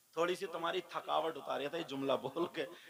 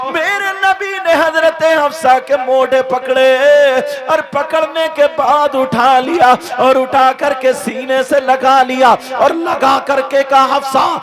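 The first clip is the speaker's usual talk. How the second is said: loud, driving sermon at full force; fiery preaching; 8 semitones higher, 26 dB louder than usual. Acted. Preacher tells the crowd of the Prophet's kindness, fiery full-force preaching. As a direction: preach it out with fervour.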